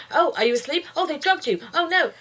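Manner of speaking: high, hammed-up fretting; imitating a panicking dub voice; mock panic. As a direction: overplay the dub's panicky worry. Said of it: "she's like".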